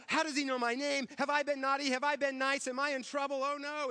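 put-on a frightened six-year-old fretting; scared child. Boy on Santa's lap fears trouble, mock panic. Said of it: "He was so afraid".

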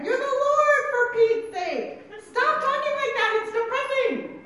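loud, high-pitched comic pleading; exasperated protest. Acted. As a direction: object in hammed-up dismay. Acted protest of Peter to Jesus.

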